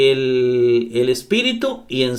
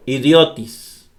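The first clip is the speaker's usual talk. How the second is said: idiotis slowed, stressed as idiÓtis; stress on the accented O; slower than usual. Shown as a demonstration. Teacher repeating the Greek word after showing its accent, stress idiÓtis. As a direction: lean on the O in idiotis. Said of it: "El acento va aquí".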